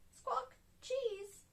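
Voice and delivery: high parrot squawk and copy; squawk, then echo the word up high; Polly Parrot. A narrator's reading. Polly Parrot copies Grampy Rabbit's order, high squawky voice. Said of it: "Polly Parrot copied".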